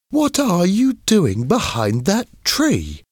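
put-on high-pitched boy, questioning; schoolboy character. A narrator's reading. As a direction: play a curious schoolboy, voice pitched right up. Alan calls to Billy, story.

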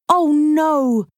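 high, drawn-out cry of dismay; dismayed, pitched well up. Acted. Heather hears the statue is gone, dismayed.

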